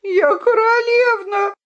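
high, sobbing plea. A narrator's reading. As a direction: cry out the words through tears. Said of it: "рыдала Виолинка".

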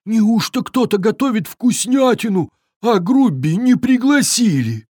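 high-pitched, aggrieved troll voice; woeful troll. A narrator's reading. Uninvited troll Grubbi complains to himself, woefully. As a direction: lament being left out, high and woeful. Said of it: "Тролль горестно всхлипнул".

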